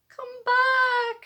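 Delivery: very high, loud desperate shout. Acted. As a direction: call after the bird that took the cone. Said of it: "shouted Cyril".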